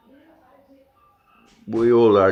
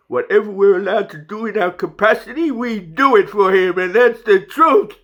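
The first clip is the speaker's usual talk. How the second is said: rising emotion, higher and louder; emotional; 9 semitones higher, 5 dB louder than usual. Real, not acted. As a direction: voice swelling with feeling as it insists. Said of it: "Why am I getting emotional?".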